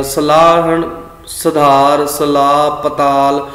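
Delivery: ਸਲਾਹਣ, ਸੁਧਾਰ, ਸਲਾਹ, ਪਤਾਲ each with a short first vowel; dropped long vowels. Shown as a demonstration. Teacher lists misread words, ਸਲਾਹਣ, ਸੁਧਾਰ, ਸਲਾਹ, ਪਤਾਲ begun short. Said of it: "ਕੰਨਾ ਅਸੀਂ ਛੱਡ ਹੀ ਦਿੰਨੇ ਆ".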